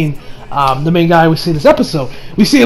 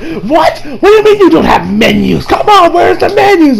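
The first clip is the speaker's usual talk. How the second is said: high-pitched comic outrage; mock incredulous; 9 semitones higher than usual. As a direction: protest in exaggerated disbelief. Customer incredulous at having no menus.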